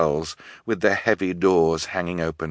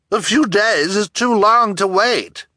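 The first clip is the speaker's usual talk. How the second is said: high, loud objection; indignant, high-pitched and forceful; impatient townswoman; 14 semitones higher, 6 dB louder than usual. A narrator's reading. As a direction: protest the delay in a high woman's voice.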